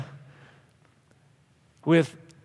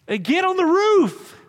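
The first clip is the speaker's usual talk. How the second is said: higher, louder, slower, building; rising excitement; 10 semitones higher, 4 dB louder, slower than usual. Real, not acted. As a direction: climb with urgency. Preacher building intensity.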